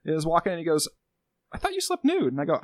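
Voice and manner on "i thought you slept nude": much higher, puzzled partner voice; a hammed-up high squeak of disbelief; partner's voice. Partner questioning him at bedtime, high puzzled voice.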